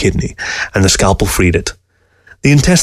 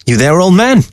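Fox calling out; 9 semitones higher than usual. A narrator's reading. Fox calls out to Newberg.

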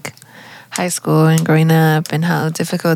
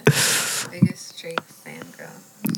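slow low whisper; whispered tease; 11 semitones lower, 9 dB quieter, much slower than usual. Real, not acted. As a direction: mutter the jab under breath. Teasing aside, whispered.

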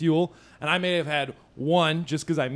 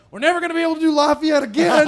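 high, loud, fast comic wail; mock panic; 11 semitones higher, 7 dB louder, much faster than usual. Pulled-over comic sees his life flash by, high rushed mock panic. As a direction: blurt it out in hammed-up despair.

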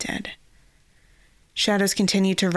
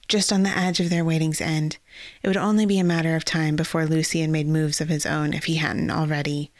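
plain reading voice

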